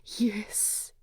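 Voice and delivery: soft whispered yes. Acted. Bilbo whispering his answer to Thorin. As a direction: breathe the yes.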